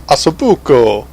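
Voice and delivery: hammed-up mock Italian accent; terrible Italian accent. Shown as a demonstration. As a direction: badly fake an Italian accent on the dish name. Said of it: "That was my Italian accent".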